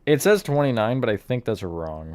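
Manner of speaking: ordinary talk, nothing marked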